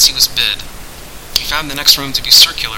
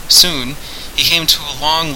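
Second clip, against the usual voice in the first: usual speaking voice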